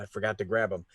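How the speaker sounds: ordinary delivery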